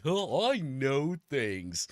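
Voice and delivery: slow smug know-it-all voice. Retold or imitated. Mocking the smug know-it-all, slow and smug. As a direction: drag it out smugly. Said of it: "that smug attitude".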